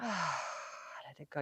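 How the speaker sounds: slow exhale sigh then release; dramatic sigh. Shown as a demonstration. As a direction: sigh and let go slowly. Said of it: "pumping out".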